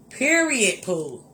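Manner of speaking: usual speaking voice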